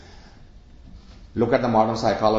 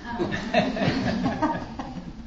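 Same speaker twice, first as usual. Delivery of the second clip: audience laugh; 13 semitones higher, 5 dB quieter than usual. Real, not acted. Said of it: "laughter at child's line".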